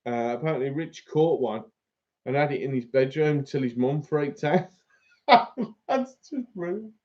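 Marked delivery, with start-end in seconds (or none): 5.3-5.8 burst of laughter. Real, not acted. Laughs at bat story.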